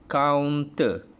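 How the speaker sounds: sebut "counter" dengan jelas dan kuat; modelled word. Shown as a demonstration. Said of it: "perkataan yang kita akan tengok yang pertama".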